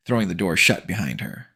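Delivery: usual speaking voice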